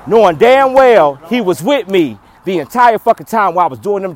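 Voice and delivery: loud, high, shouted tirade; furious rant. Real, not acted. Ranting loudly at the people who doubted him. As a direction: yell it out, fed up and defiant. Angry rant about taking care of man.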